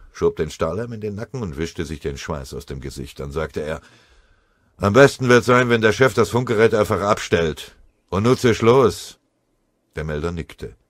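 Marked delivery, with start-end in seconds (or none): 4.8-9.2 laut abweisend. A narrator's reading. Scharf schickt den Melder weg.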